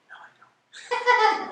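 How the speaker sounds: laughing. Retold or imitated. Laughing while retelling own answer.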